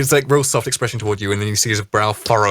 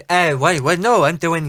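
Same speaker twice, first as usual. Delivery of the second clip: first mate voice; 6 semitones higher, 4 dB louder than usual. Acted. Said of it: "he goes".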